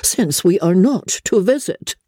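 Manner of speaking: high-pitched resentful mother. A narrator's reading. Mother on the visit they won't make, resentful. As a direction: say it with pointed resentment. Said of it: "said her mother resentfully".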